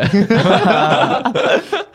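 high laugh that climbs; rising laugh, recalling the annoyance. Real, not acted. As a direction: laugh up and away.

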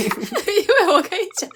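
high, slow, talking through laughter; laughing. Real, not acted. Explaining to cohost why she laughed, giggling. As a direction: try to explain while still giggling.

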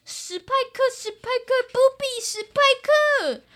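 high panicked bug voice; panicked cry. Acted. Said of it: "惊慌失措地说".

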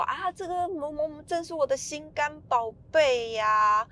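高八度，拖着腔，像妈妈在夸宝贝儿子; 装出偏心妈妈那种甜腻的语气; 宠溺的妈妈. Retold or imitated. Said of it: "然后他妈妈就说". (模仿偏心妈妈夸心肝宝贝，声音明显偏高)

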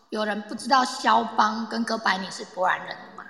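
ordinary talk

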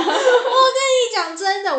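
real giddy laughter spilling into the words; so happy it comes out half laughing, half crying. Real, not acted. Laughing while recalling joy.

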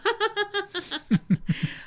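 high auntie cackle. Pretend. Auntie impression ends in cackle.

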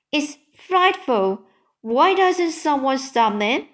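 high-pitched, mildly dismayed appeal; mild cry of protest. A narrator's reading. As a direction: protest with a touch of alarm. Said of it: "Sophie cried".

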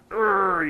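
loud, high growl of frustration; frustrated groan. Shown as a demonstration. Teacher acting out frustration to the sky, loud growl. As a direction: let out an angry urgh at the sky. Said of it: "get frustrated and scream at the sky".

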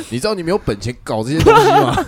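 loud, high angry scolding of a patient; an irritated doctor chewing out a patient for his habits; fierce scolding doctor. Retold or imitated. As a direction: bark at him like a furious doctor. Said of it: "超凶".

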